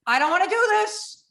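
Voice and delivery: high, loud panicked plea; retold panic. Strapped in at the plane door, high panicked plea. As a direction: beg not to be let out, high and loud. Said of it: "now I'm like".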